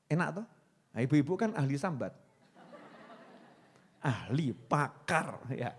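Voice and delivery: ordinary delivery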